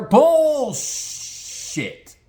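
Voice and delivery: high, drawn-out cry of disbelief; scoffing high exclamation; scornful outburst. Real, not acted. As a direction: call it out, stretching the first syllable. Scornful outburst at trust story.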